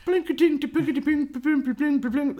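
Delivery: high sung banjo plinks; banjo imitation. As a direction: mouth a banjo strum, high. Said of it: "That's the banjo".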